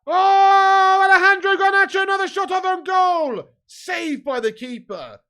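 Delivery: real excited yell as the shot flies in; excited shout. Real, not acted. Fan yelling at a late United chance. Goal chance in watched match.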